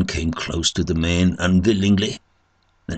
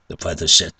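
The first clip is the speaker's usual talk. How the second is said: usual speaking voice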